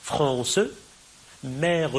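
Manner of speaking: "France" and "mère" as two syllables, final E voiced; pronounced mute E. Shown as a demonstration. Teacher showing two pronounced mute Es, final E sounded in "France", "mère". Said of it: "mais qui sont prononcés".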